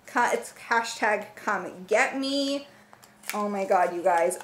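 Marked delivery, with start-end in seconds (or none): none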